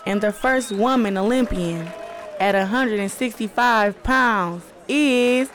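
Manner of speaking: drawn-out announcer's build, rising on the name; imagine the announcer introducing you, stretching each phrase slowly; ring announcer. Acted. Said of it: "I can hear the announcer. I mean, they gonna say it, like".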